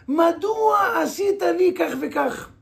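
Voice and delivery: loud, high demand of "why did you do this to me"; shouted question. Retold or imitated. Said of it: "אלא יאמר לו".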